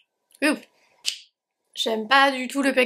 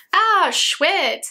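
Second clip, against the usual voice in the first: bright pleased exclamation; excited; 5 semitones higher than usual. Real, not acted. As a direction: perk up at the product. Unboxing a new product.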